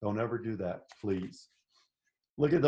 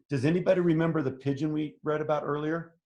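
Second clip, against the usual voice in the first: usual speaking voice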